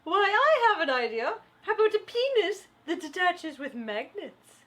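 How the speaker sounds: high, loud, hammed-up cheery caller; exaggerated high-pitched mock enthusiasm; chirpy idea-giver. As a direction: sing out the greeting, then pitch the idea brightly. Skit, cheerily offering a swapped idea.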